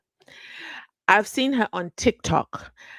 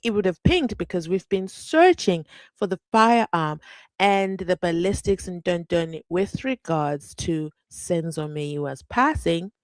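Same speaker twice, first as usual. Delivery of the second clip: ordinary talk, nothing marked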